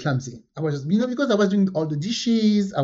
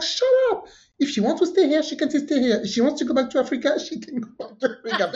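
put-on young guy; 8 semitones higher, faster than usual. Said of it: "He's like".

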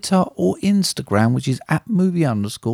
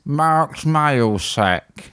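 announcer; much slower than usual. Acted. Announcing the segment name.

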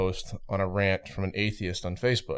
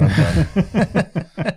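laughing; 9 semitones higher, 6 dB louder than usual. Real, not acted. Giving up amid laughter.

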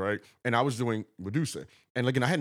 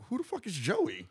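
1 indignant rival; 12 semitones higher than usual. Retold or imitated. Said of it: "And he was like".

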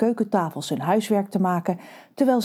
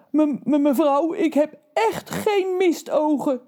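stammering giant; 8 semitones higher than usual. Acted. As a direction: stutter it out, scared of the mother. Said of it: "stamelde Reus". (hoge, trage stamelende reus)

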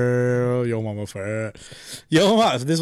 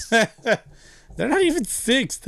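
laughing; 11 semitones higher, much slower than usual. Real, not acted. Laughing at a teammate's claim.

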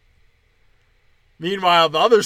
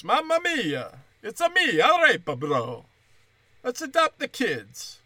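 high Italian plumber voice; hammed-up Italian cartoon plumber; Mario voice; 6 semitones higher than usual. Mario impression.

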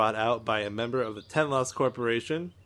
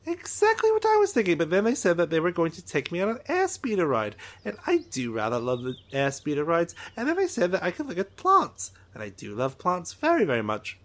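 excited alien Trixel; 5 semitones higher, faster than usual. Acted. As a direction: greet him like an overeager little alien. Drawl chatters about rides.

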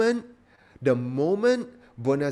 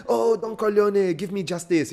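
desperate plea; 9 semitones higher, 5 dB louder than usual. Retold or imitated. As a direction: whine it like a last resort. Mocking Bonazera's desperate plea.

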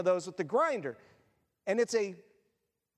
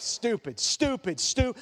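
scolding himself step by step; a chant of mild self-reproach; grumbling at his own foolishness; muttered self-scolding; slower than usual. Retold or imitated. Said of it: "each step is just".

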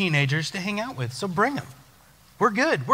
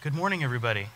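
low, soft repeat of the greeting; subdued retake; 7 semitones lower, 7 dB quieter, much faster than usual. Real, not acted. Pastor retrying the greeting to the congregation, subdued. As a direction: greet them quietly, dropped in pitch. Let's try that again.

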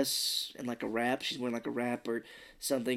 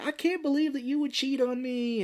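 mock whiny; 12 semitones higher than usual. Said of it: "she's just like".

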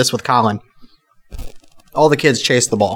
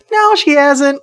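quoting himself; 13 semitones higher, much slower than usual. Retold or imitated. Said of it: "And I go".

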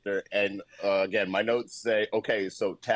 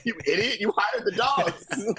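mock scolding; 10 semitones higher than usual. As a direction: tease the director like a fool. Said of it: "You're directing the movie".

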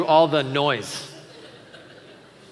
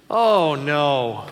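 louder, drawn-out oh no; sympathetic oh no; 5 dB louder than usual. Shown as a demonstration. Dad commiserating with his kid, sympathetic. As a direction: groan it in sympathy. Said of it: "it goes like this".

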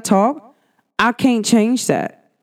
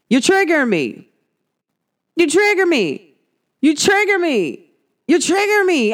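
higher-pitched mimicked whine of protest; mock complaint; 8 semitones higher than usual. People complaining to the speaker about being triggered, mock complaint. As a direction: repeat it as a high, mocking accusation.

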